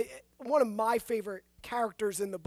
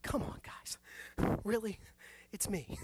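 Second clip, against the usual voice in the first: exasperated; 3 semitones lower, 8 dB quieter than usual. Pretend. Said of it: "I'm like".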